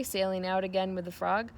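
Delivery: ordinary delivery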